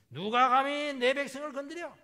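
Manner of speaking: roaring, high and loud, stress on 내; furious shout. Acted. God shouting at the enemy army, furious roar. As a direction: bellow 누가 감히 내 백성을 as a furious father. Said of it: "막 소리부터 치고 봐요".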